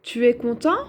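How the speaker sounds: TU ES CONTENT rising at the end as a question; questioning tune. Shown as a demonstration. Teacher turning a statement into a question, rising questioning tune. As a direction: say "Tu es content?" with the pitch climbing on CONTENT. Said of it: "si j'écris le point d'interrogation".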